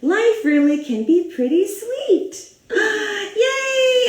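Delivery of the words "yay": much higher, shouted yay; joyful cheer. Acted. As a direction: celebrate the happy ending. Happy ending celebration.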